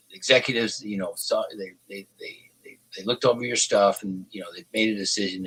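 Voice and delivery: low, soft, hesitant delivery; measured, subdued phone-call tone; Lynn's very calm voice. Retold or imitated. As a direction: imitate a calm caller, giving nothing away. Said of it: "It was a very calm voice".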